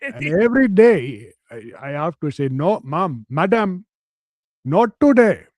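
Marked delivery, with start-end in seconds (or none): 2.5-5.4 rising to a higher, louder mock Indian refusal; mock Indian accent.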